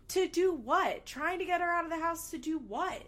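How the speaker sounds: incredulous. Real, not acted. Incredulous rant.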